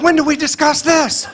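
high mocking wife. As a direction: squeak it out like a bossy wife. Wife's voice, high mock.

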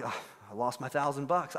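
low, soft, mock-miserable sigh of defeat; mildly hammed-up gloom; dejected friend. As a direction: act crushed by the loss, voice sunk down. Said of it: "He's so sad".

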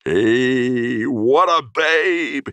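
high, loud, long-drawn admiring whoop; slow, rising cry of delight; excited cry. A narrator's reading. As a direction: stretch it out, crowing with admiration. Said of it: "he cried".

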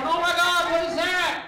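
high, loud startled shriek; shocked kid. Retold or imitated. Kid bursting in on naked parent, shrieking in shock. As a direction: gasp at the sight. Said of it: "somebody banging through the door going".

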